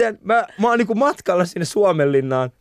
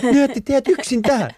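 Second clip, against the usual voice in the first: put-on alarmed adult to kids; 7 semitones higher than usual. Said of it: "Mä olisin, että".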